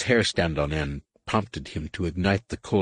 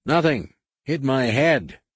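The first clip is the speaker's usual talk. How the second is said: calling back; 7 semitones higher, 3 dB louder, slower than usual. A narrator's reading. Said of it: "the answer came back".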